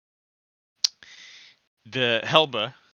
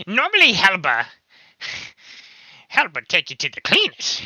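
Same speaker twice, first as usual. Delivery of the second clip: goblin voice; 9 semitones higher, 8 dB louder than usual. Acted. Goblin boasting.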